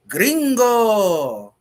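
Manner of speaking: huge high holler, last vowel drawn out; long, loud teasing roar; boisterous grandfather bellow. Acted. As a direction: holler it like a booming announcer, stretched long. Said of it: "his boisterous voice".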